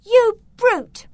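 high, loud indignant shout; shouted outrage. A narrator's reading. Beatrice grabs the servant's arm, shouting angrily. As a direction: shout it at the top of the register. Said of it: "grabbed the servant's arm and shouted".

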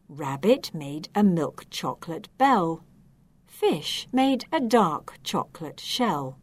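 plain reading voice

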